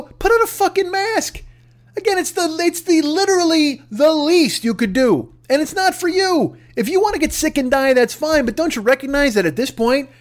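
high-pitched exasperated plea; ranting, voice up high. Real, not acted. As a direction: push it up high in disbelief. Ranting demand.

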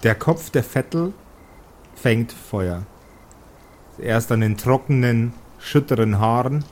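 dark fireside storytelling; game master narration. A narrator's reading. GM narrates the burning hag.

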